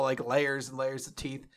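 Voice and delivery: ordinary delivery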